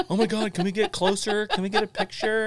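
high, loud, pleading excitement; hunting buddy begging to get closer to the dolphins; eager tourist. Retold or imitated. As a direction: beg for a closer look like a kid. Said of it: "he did the same thing with the dolphins".

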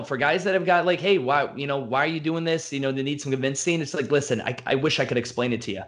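ordinary delivery

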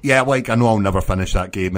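ordinary delivery

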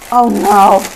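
loud startled cry of dismay; sudden loud groan of dismay. Real, not acted. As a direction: blurt it out on spotting the damage. Finds a damaged salad bag.

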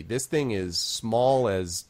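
usual speaking voice

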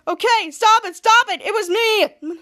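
loud, high acted plea; pleading confession. Bo confessing to stop the zapping, urgent mock plea. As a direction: call out to halt the attack.

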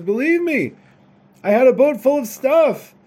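high, loud, exasperated protest; yelling. Acted. Son insisting to his disbelieving father, yelling. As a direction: yell it, desperate to be believed. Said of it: "the son yells at the father".

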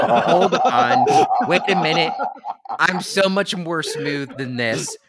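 loud, laughing objection to the story; laughing protest. Real, not acted. Friend laughing, correcting the version of how he met her. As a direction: laugh it off while protesting loudly, slow at the end.

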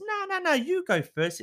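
high, eager insistence; coaxing husband. Acted. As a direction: push him to go first.

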